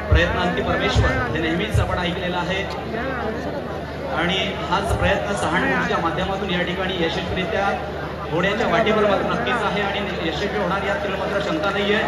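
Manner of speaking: normal talking voice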